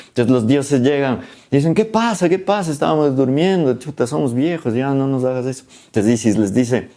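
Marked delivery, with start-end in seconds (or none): 1.8-5.7 much higher, whiny woken-up elders; grumpy old gods. Retold or imitated. Old gods woken by the bell, whining much higher. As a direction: complain like sleepy old men.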